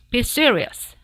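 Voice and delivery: loud sharp rebuke. Acted. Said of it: "said the dentist sharply".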